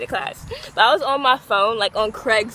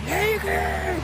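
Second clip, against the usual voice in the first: mock puppy voice; 6 semitones higher than usual. Voicing the dog's reply.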